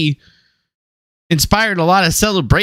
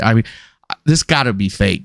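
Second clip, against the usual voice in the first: usual speaking voice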